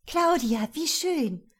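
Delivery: high, gushing cry of delight; effusive greeting. A narrator's reading. Young bride greets her fiancé's ex at the airport, effusively. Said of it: "rief Liliana überschwänglich aus".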